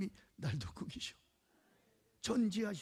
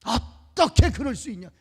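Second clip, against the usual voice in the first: mock rebuke; 6 semitones higher, 14 dB louder than usual. Harsh leader's rebuke quoted.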